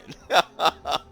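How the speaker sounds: loud, high burst of laughter; big laugh. Acted. He laughs at Nora's teasing, a loud high burst.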